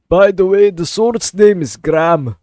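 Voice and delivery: loud, high, slow mock Scottish; Scottish accent. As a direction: proclaim it in a put-on Scottish accent. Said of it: "I don't know why I'm doing this accent".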